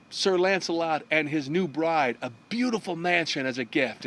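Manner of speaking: plain reading voice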